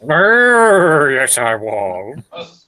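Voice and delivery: high brr then slow drawl; comic camp retort. Pretend. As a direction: camp it up, stretch it out. Comic Kenneth Williams-style retort.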